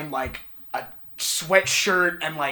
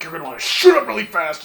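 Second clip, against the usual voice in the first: loud, fast, higher burst of instruction; dead serious; 5 semitones higher, 8 dB louder, faster than usual. Retold or imitated. Gym stranger demonstrating a curl to a kid, dead serious. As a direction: imitate a very earnest coach firing it out. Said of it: "And he was dead serious".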